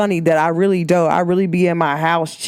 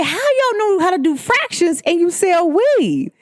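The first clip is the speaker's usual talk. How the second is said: higher, amazed disbelief; teacher amazed at the weed seller; amazed question; 10 semitones higher than usual. Retold or imitated. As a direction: ask it in total disbelief. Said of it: "I said".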